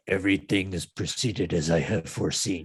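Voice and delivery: loom over them like a dark emperor. Acted. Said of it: "do my best Emperor voice here".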